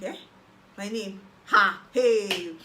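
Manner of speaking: a disbelieving Eh, then a loud joyful Ha and Hey; half-laughing shout of joy; excited disbelief. Retold or imitated. Learning she got admitted, shouting in disbelief. Hearing her name is on the list.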